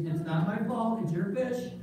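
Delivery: normal talking voice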